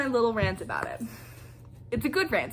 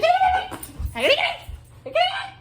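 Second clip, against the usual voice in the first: mock panicked squeal; much faster than usual. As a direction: replay your own frantic panic, laughing through it. Panicking that Freddy got captured.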